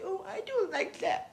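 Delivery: high, timid little voice; meek pushover. Retold or imitated. Meek voice for a bully who will walk over you. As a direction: squeak it out like a pushover.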